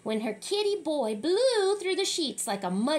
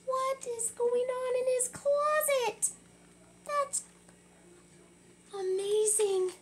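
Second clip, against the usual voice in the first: high, amazed wonder; 11 semitones higher than usual. Real, not acted. Reader marvels at the closet.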